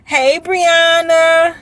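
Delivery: high, loud, long-held hello; excited greeting. Real, not acted. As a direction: sing out a delighted hello, slowly stretched. Calling out to a viewer.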